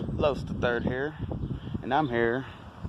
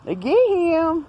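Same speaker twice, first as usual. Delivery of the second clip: high, loud and slow exclamation; excited high voice; 7 dB louder, slower than usual. Pretend. Vlogger hamming up a creature statue, high and loud. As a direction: pretend delight at the statue.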